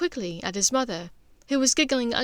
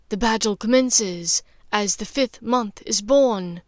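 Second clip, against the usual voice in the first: slow, grave, portentous; ominous prophecy; 6 dB louder than usual. Acted. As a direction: intone it like a prophecy. Prophecy quoted slowly.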